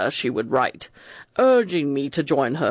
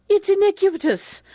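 shrill, much higher outraged cry; a young woman's high indignant outburst; 3 dB louder than usual. A narrator's reading. Vera crying out against the accusation, high and indignant. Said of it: "Vera cried out".

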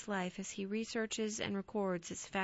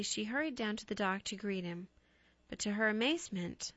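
plain reading voice

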